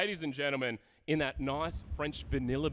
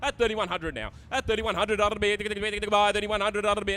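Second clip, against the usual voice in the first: rapid rolling auction call; auctioneer calling; 6 semitones higher, 6 dB louder than usual. Acted. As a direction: rattle off the bids fast. Live auction chant.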